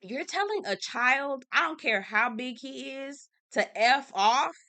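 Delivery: normal talking voice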